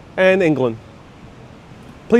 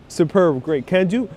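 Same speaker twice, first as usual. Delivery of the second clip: ordinary delivery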